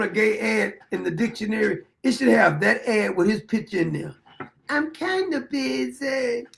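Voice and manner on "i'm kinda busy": slow, drawn-out kinda, a light parody; a hint of the ad guy's brush-off; mock ad voice. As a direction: stretch it out like the ad. Parroting the ad's line.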